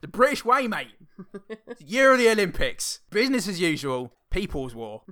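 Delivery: high, loud opening settling to normal; hammed-up British rallying slogans; mock patriotic proclamation. Mock slogans voiced for the character.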